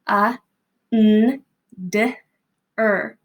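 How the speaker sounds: segmenting under sound by sound; each sound separated, pausing between. Shown as a demonstration. Teacher segmenting a spelling word for families. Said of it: "The word is under, get ready, go".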